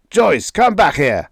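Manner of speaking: high, loud order; commanding call. A narrator's reading. Father ordering his leaving daughter back, loud. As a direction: call her back sharply.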